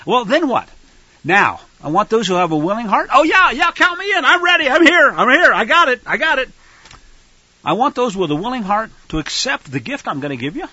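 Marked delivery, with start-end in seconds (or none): 3.1-6.5 much higher, louder, eager and rushed; eager volunteers. Pretend. The willing-hearted people eagerly volunteering, high and excited. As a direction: jump in like a keen volunteer shouting yes.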